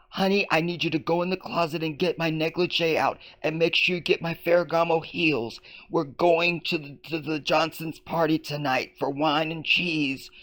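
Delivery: high-pitched affected newsreader voice for domestic chat; reporter's tone at home. Retold or imitated. Host parodies reporter talking to her honey, affected high voice. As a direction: give household orders in a high, theatrical report cadence. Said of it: "You think she talks like that?".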